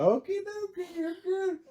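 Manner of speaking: high, cheery sing-song brush-off; lighthearted reassurance. Retold or imitated. Clerk humoring a tripping customer, high and lighthearted. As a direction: keep it light and breezy, pitched right up. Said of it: "keep the conversation lighthearted".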